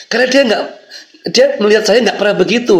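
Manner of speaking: laughing. Real, not acted. Laughing while retelling his anger.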